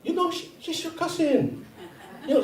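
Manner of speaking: high-pitched mock mother; mom's voice. Mom revealing the girl is his cousin, high mock-mom voice. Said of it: "You go to my mom".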